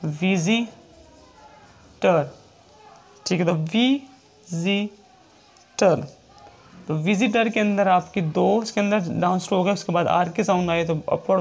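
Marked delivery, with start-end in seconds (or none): 0.0-6.2 very slow, drawn-out word; teacher dictating an example; slow dictation. Shown as a demonstration. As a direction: stretch the word for the class. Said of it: "example जैसे".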